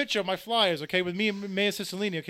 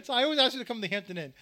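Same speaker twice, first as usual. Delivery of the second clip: ordinary talk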